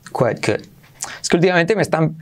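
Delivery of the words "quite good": lower, softer English quite good; British-style quite good; British accent. Shown as a demonstration. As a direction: say it as in England. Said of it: "en Inglaterra dicen más quiet".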